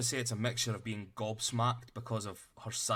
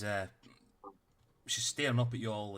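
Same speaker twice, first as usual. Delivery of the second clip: usual speaking voice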